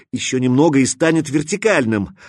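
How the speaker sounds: plain reading voice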